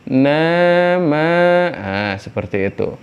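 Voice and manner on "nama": "Nama" with the a drawn out two counts; recited like Quran reading; recitation demo. Shown as a demonstration. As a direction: hold the long a in "Nama" for two beats. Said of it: "ini dua".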